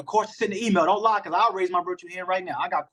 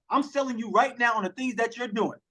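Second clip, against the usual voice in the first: ordinary delivery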